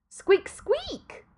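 high squeaky rat voice; two quick sharp squeaks. Acted. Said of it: "said the death of rats".

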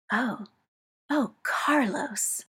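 breathy, urgent, slowed half-whisper; breathless and urgent. Acted. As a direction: breathe his name out, overwhelmed. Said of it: "Breathless and urgent".

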